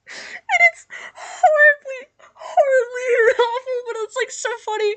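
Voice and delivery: high, slow laughing. Real, not acted. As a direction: stretch it out through laughter. Laughing at the awful fan fiction.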